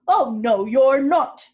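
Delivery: loud troll boom. A narrator's reading. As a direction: bellow it out as the troll. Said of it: "said the troll".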